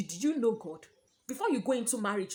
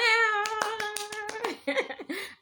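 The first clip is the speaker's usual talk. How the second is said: bright laugh; 13 semitones higher, 5 dB louder than usual. Real, not acted. Laughing at the channel greeting.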